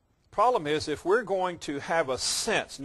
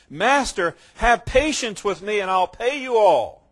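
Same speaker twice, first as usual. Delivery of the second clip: raised voice begging for more time; loud pleading servant; 6 semitones higher, 7 dB louder than usual. Retold or imitated. Servant on his knees begging the master, loud plea. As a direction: plead loudly, on your knees. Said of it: "fell down before him, saying".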